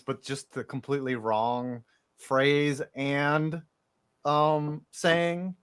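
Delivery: ordinary delivery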